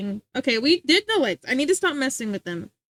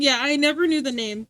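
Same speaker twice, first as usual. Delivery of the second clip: usual speaking voice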